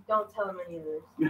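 imitating Dad murmuring a warning aside; hushed warning. Dad warns his son out of earshot, hushed. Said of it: "Dad looks at me and goes".

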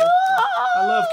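high, loud drawn-out count; gleeful shout. Acted. Landlord cheers as he finishes counting rent. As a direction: shout the last number in triumph.

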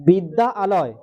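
বিদ্যা আলয় said slowly as two separate parts; broken into parts. Shown as a demonstration. Teacher shows pupils how to say it, বিদ্যা আলয় slowly split. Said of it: "এরকম বলবি".

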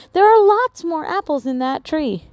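Molly's voice. Acted. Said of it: "said Molly".

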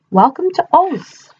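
slow, sing-song greeting; welcoming munchkins. Acted.

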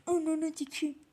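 ordinary delivery

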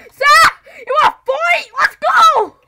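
high, very loud challenge; mock fighting shout. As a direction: holler like picking a fight.

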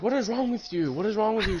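high-pitched, incredulous questioning; retold bewildered demand. Asking a friend who is cussing out furniture, incredulous. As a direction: demand an answer in disbelief.

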